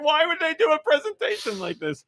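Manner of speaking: high, incredulous whine. Retold or imitated. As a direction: ask it in high disbelief. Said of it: "I was like".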